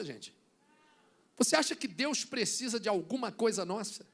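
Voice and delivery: normal talking voice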